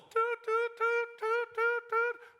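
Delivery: high, loud mock whistle toots; whistle imitation. Coach double-blowing the whistle, mimicked loud and high.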